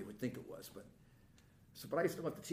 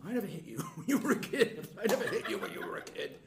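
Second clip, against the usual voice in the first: hammed-up threat from an old boxer dad; mock angry father; 5 semitones higher than usual. Father storms in and threatens his son, mock angry.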